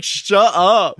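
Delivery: high, loud snapped order; mock shout. General silencing the kid, high loud shout. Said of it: "And he's like".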